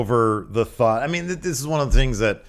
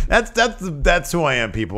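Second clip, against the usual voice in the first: ordinary talk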